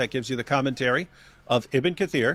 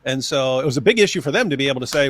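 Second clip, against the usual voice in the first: ordinary delivery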